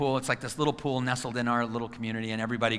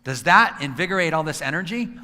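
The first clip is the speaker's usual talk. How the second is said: ordinary delivery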